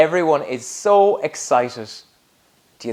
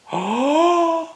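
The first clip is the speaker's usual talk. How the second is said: sharp high gasp of pretend surprise; high mock gasp; 12 semitones higher than usual. Reader reacts to the knight.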